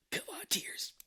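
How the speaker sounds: high strained whisper; hammed-up whispered begging; willing tears out. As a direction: whisper a desperate mock plea to yourself. Mimics actor willing himself to cry.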